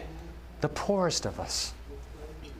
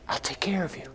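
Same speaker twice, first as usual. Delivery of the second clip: whisper; 2 semitones higher than usual. Real, not acted. Tender promise from God.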